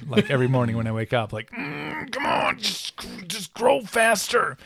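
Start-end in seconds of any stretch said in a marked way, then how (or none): 1.5-4.6 strained, pleading with his beard; straining voice. Retold or imitated. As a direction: squeeze the words out, half-groaning. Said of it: "I've been making the poop face".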